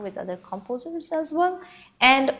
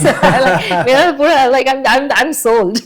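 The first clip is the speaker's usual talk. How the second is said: laughing; 4 semitones higher, 13 dB louder than usual. Real, not acted. Laughing through excited praise.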